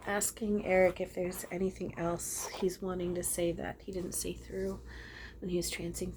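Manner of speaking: whispered aside. Real, not acted. Checking in with Eric, she whispers it aside. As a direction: whisper the question inward. Consulting the spirit quietly, aside.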